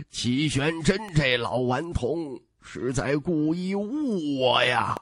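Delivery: 拖慢语速的无奈感叹; slow, drawn-out rueful sigh of a line; 轻声叹息. A narrator's reading. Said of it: "轻声叹息". (慢慢地感叹，无奈一叹)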